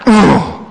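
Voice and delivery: high whiny "Oh!"; mock a schoolgirl groaning at a lesson; pupil's groan. Imitating pupil Tina groaning.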